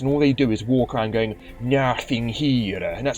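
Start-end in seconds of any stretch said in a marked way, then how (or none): 1.6-2.8 drawn-out mock Russian accent; bad Russian accent. Gamer mimicking masked game guards, bad Russian accent. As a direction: drag it out in a silly Russian accent. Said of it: "stupid Russian accent".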